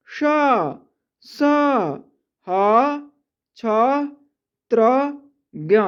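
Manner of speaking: slow, spaced-out letter recitation; reciting letters. Shown as a demonstration. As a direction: call each consonant clearly with pauses. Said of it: "तो पढ़ेंगे स". (अक्षरों का धीमा उच्चारण)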